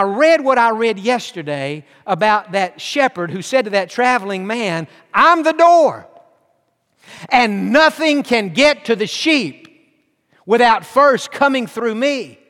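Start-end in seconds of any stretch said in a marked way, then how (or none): 5.1-12.4 higher, louder, slow proclamation; shepherd's declaration. Acted. Preacher voicing the shepherd to the traveller, proclaiming. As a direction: declare it like a guardian at the gate.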